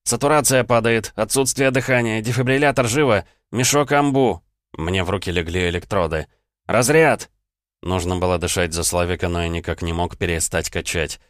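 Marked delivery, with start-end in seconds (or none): 0.0-4.4 loud, high urgent shout; shouted alarm. Acted. Doctor shouting to the nurse as the patient crashes. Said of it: "заорал я". 6.6-7.3 loud, high sharp command; urgent shout. Acted. Doctor shouting the shock order over the patient.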